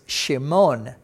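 Shimon as shee-MON; Hebrew pronunciation. Shown as a demonstration. Teacher introducing the Hebrew name, Hebrew pronunciation. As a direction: stress the last syllable of Shimon. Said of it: "the accent ... is on the last syllable".

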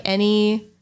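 usual speaking voice

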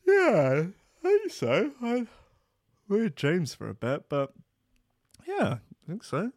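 high, drowsy mumbling reply; half-asleep partner, high and vague; sleepy partner. Acted. Sleepy partner answering the morning question, high and drowsy. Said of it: "And they go".

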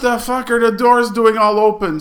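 high, loud indignant demand; retold outrage. Lieutenant finds the aircraft doors open, mock outraged. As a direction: act out an officer's outburst.

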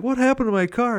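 high, indignant cry; mock outraged mayor. Retelling the mayor's reaction to his destroyed car, mock outraged. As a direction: yelp it like a man whose car was wrecked. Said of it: "he's like".

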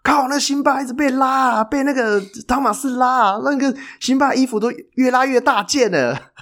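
high, teasing fan heckle; mocking fan in the stands. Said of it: "一直讲说".

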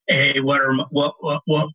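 halting false starts, stuck repeating "what"; nervous stuttering. Retold or imitated. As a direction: imitate a tongue-tied, stuttering caller. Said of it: "I'm, like, stuttering".

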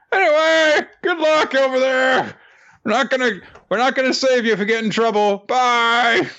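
high, mocking voice of a senior student. Senior student mocking the first years, high and mocking. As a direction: sneer it out in a high voice.

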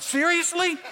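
high-pitched disbelieving squeal; mock incredulity. Incredulous reply to migraine side effect.

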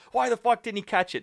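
high-pitched mock outrage; griping fan. Mimicking an absurd fan blaming the receiver, high indignant whine.

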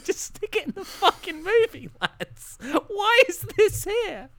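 high-pitched, incredulous complaint; squeaky exasperation with laughter breaking in; exasperated rant. Real, not acted. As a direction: let the pitch climb into comic disbelief. Comic exasperated rant about pointless scene.

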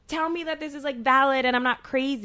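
high, pleading retold outcry; crying out. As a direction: plead for reassurance, rising in volume. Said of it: "almost like a cry out".

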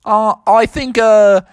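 high, hammed-up Aussie kangaroo; Australian accent. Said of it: "your Australian accent sure is awesome".

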